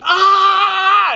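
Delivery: high, loud shriek of shock; a real-sounding startled scream. Retold or imitated. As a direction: let out a sudden scream. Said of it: "I screamed".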